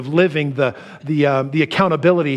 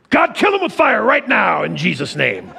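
higher, laughing fake prayer; mock road-rage prayer; 5 semitones higher than usual. Driver cut off on the road, laughing mock prayer. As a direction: pray for fire half-joking.

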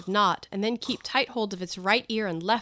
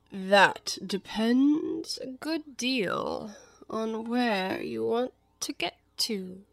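very slow, drawn-out, whispery cat voice; Cheshire Cat; much slower than usual. Acted. The cat answers Alice's question about the way, languid. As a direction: stretch each word out languidly.

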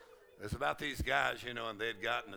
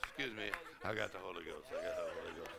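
lower, softer, quick embarrassed excuse; sheepish apology; 7 semitones lower, 8 dB quieter, much faster than usual. Retold or imitated. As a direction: murmur it fast, a bit embarrassed. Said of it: "You say".

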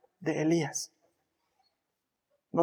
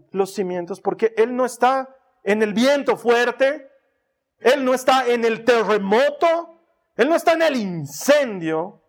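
loud, higher forceful preaching; booming preacher; 5 semitones higher, 6 dB louder than usual. Acted. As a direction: thunder it out like the earthquake. Contrasts wind, earthquake, fire.

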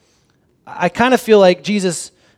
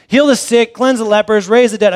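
offhand Jesus; 4 semitones higher, 6 dB louder than usual. Retold or imitated. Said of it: "Jesus is saying, you know, "Here, go and do all this".

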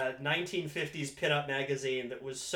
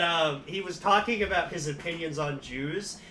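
usual speaking voice

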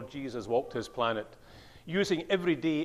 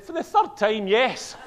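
exasperated wife; 8 semitones higher, 11 dB louder, slower than usual. Retold or imitated.

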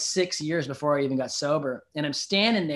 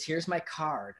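usual speaking voice

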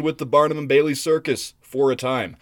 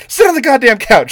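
much higher, much louder shrieked order; clown mom yelling; 11 semitones higher, 10 dB louder than usual. Retold or imitated. Clown mom ordering her son to the couch, loud shriek. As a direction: screech the order like an angry clown. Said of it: "And then she, like".

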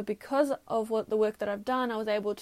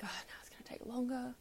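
whispered grumble; 2 semitones higher, 10 dB quieter than usual. Real, not acted. Muttered aside while waiting.

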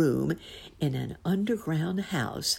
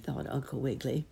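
ordinary delivery

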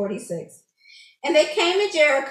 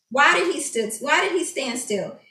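normal talking voice

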